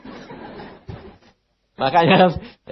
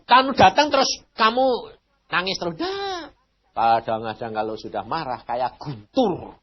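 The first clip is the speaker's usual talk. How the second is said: usual speaking voice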